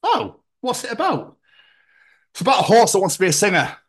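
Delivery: high, quick eager questions; bubbly and curious; chirpy texter. Retold or imitated. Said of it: "Text message conversation".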